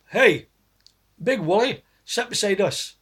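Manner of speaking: loud, high call across a pub; shouting across the pub. Retold or imitated. Pub friends hail Big Wally, loud high shout. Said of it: "They would shout".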